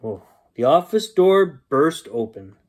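ordinary delivery, nothing marked

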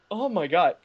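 usual speaking voice

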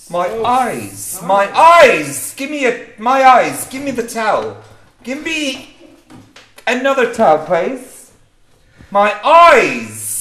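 high, loud yelps of pain; pained shouting. Acted. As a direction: scream for the towel, eyes burning.

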